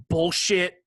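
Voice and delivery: usual speaking voice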